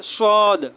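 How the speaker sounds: সদ said thin and light, like a bird's whistle; thin whistling sad. Shown as a demonstration. Teacher showing students a thin bird-like সদ. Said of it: "একটু চিকন করে, পাতলা করে ... পাখির মতো শব্দ হবে".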